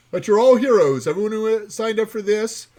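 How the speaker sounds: excited. Real, not acted. Hyping giveaway winners.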